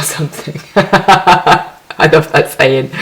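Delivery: words squeezed out between laughs; laughing. Real, not acted. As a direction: talk through a giggle. Giggling at her own slip.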